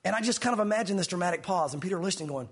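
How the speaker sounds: ordinary talk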